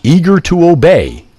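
ordinary delivery

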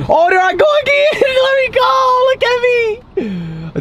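very high screaming with laughter; ecstatic screaming. Real, not acted. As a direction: scream with pure joy. Sees himself on billboard again.

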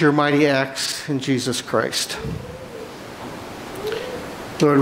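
ordinary delivery

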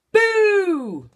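high, loud gleeful gotcha; triumphant surprise shout; shouted boo. A narrator's reading. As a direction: leap out and shout it together. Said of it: "they all said, laughing".

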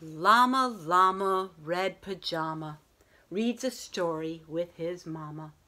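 lilting rhyming read-aloud voice; bedtime story reading. A narrator's reading. Teacher reading a bedtime story to her class, gentle and lilting. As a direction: read it like a picture book at bedtime.

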